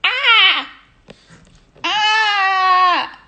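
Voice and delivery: high, loud "ah" cries; high drawn-out yell; loud squeal. Real, not acted. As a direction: squeal it out twice, the second longer. Squealing during birthday shout-outs.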